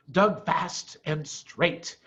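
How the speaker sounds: usual speaking voice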